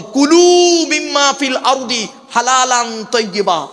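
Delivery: slow chanted Arabic verse; Quran recitation. Acted.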